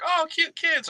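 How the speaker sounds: put-on high cooing "oh, cute kids"; high coo, a touch creepy. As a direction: gush over the kids like a creepy stranger. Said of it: "that creepy guy who's like".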